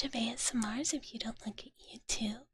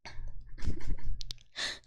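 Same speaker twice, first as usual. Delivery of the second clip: whispered giggle; 6 dB louder than usual. Real, not acted.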